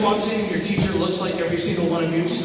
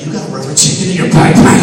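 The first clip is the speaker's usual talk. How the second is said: louder, disbelieving bully; incredulous shout; 4 semitones lower, 8 dB louder than usual. Retold or imitated. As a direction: shout it like you can't believe it.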